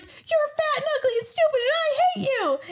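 very high-pitched mock outburst; squealed insults. Colby finally talking back to Alvida, high squealed mock defiance.

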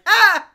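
loud, high acted "Ah!"; short burst of hammed-up revelation; mock excited scream. Film-makers react to the casting idea, mock excited scream. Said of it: "they're just like".

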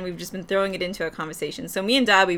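ordinary talk, nothing marked

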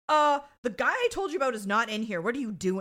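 mock scolding, starting loud; imitated yelling. Podcaster acting out the lineup scene, mock yelling. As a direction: tell them off, imitating an irate man. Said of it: "he's, like, yelling at them".

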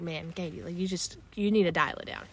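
low muttered inner voice; hushed self-scolding. Retold or imitated. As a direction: talk yourself down under your breath.

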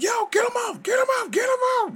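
high, loud panicked scream, over and over. Retold or imitated. Skinny coworker grabbed by fans through window, panicked high scream. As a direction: scream like you're being dragged out the window. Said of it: "He like".

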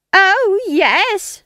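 very high, loud shriek. A narrator's reading. Nanny shrieks back at Granny mid-quarrel. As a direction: screech it right up at your rival. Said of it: "shrieked Nanny".

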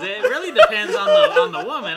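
high, loud, laughing explanation; sheepish real laughter while explaining. Real, not acted. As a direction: speak through laughter.

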